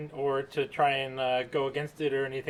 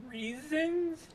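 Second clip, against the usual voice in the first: sarcastic; 12 semitones higher, 5 dB quieter than usual. Real, not acted. Sarcastic pause before word.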